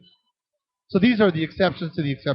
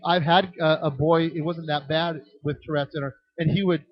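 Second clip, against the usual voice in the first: usual speaking voice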